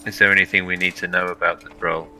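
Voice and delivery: normal talking voice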